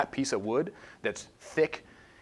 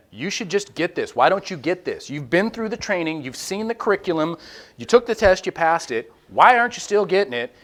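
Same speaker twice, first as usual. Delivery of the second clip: higher, louder rising exasperation; exasperated at work; 7 semitones higher, 8 dB louder than usual. Retold or imitated. Speaker at work scolding someone who won't get it, exasperated and loud. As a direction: pile on the frustration with each question.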